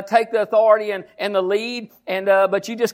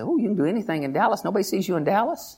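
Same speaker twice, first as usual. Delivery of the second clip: quick, soft tempting whisper-like tone; tempting devil; 3 semitones lower, 5 dB quieter, faster than usual. Retold or imitated. The devil tempting the pastor in Dallas, soft and quick. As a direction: coax him quietly and fast. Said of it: "You said".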